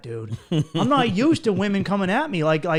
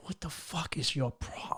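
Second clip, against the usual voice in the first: slow unvoiced whisper under the breath; hushed irritated whisper; 4 semitones lower, 14 dB quieter, slower than usual. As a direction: whisper it so she can't hear. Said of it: "and then I was like".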